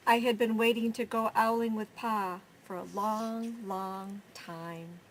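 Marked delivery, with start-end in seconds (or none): none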